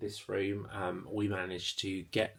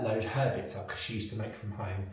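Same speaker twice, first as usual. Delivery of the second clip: ordinary talk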